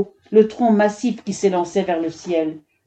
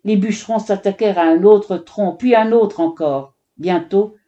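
ordinary delivery

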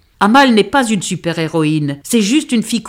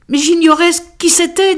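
higher pleading wail; whimpering plea; 12 semitones higher, 4 dB louder than usual. Acted. Amal pleading with her angry father, whimpering. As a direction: whine it out, near tears. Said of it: "dis-je en gémissant".

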